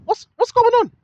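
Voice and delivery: high-pitched, baffled asking; like someone who can't believe what they see; bewildered asking. Pretend. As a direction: pretend puzzled question, with a laugh. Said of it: "you need to ask yourself".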